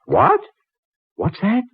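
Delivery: high-pitched, startled question; astonished. Acted. Patron stunned that the librarian knows the authors, astonished. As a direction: shoot the pitch right up in disbelief.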